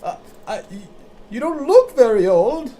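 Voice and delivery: put-on high, loud old lady voice, a bit fast; a little old lady straining to hear; deaf old lady.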